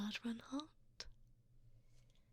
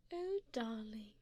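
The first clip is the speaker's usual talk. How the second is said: usual speaking voice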